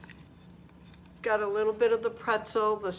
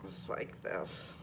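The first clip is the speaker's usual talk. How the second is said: soft unvoiced whisper; 13 semitones lower, 8 dB quieter, slower than usual. Real, not acted. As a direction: breathe the words without voice. Repeats the same words, breathless whisper.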